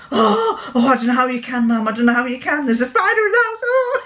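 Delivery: high, laughing whine imitating the children; mock squeamish kids. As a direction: wail it like a horrified child. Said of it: "they're going".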